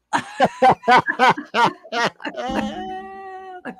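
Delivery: high staccato laugh into a long held tail; theatrical laugh. Pretend. Announcing Schustradamus is back, high theatrical laugh. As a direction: laugh high in separate bursts, then draw it out.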